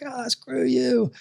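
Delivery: high, drawn-out mock grumble; put-on exasperation at another driver; mock road annoyance. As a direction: play an irritated driver without raising the volume.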